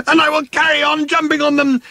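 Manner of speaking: very high shrill threat; furious yell. Acted. Arthur yelling after the fleeing workmen, shrill furious threat. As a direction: shriek it as you chase them. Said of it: "yelled Arthur".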